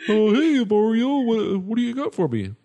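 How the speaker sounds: high, loud dopey greeting; goofy Higgins voice. Acted. Higgins greets Mario in a high goofy voice. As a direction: play him as an eager simpleton calling to Mario.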